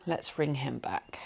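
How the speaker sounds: soft unvoiced whisper. Real, not acted. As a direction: breathe it without voice. Whispered aside before a call.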